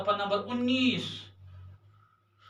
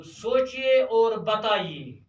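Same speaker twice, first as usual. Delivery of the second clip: सोचिए और बताइए said very slowly, each word stretched and a bit louder; slow dictation; 4 dB louder, much slower than usual. Shown as a demonstration. Teacher to his pupils, dictating सोचिए और बताइए slowly. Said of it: "पाँच सवाल number दो".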